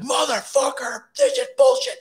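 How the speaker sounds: high, strained mock yell; retold outburst, climbing very high in pitch; re-enacted frustrated yelling. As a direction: act out cursing to yourself in a squealed fury. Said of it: "I'm yelling by myself".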